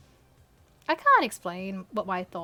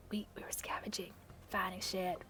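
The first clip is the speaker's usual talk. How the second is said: hushed; 3 semitones lower, 13 dB quieter than usual. Acted. Daryl's line, hushed.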